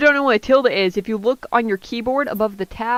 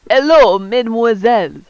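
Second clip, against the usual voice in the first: put-on thick French accent. Guru Applause greets the students in a thick French accent. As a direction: greet the girls like a flamboyant French couturier. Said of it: "in a thick, treisurely accent".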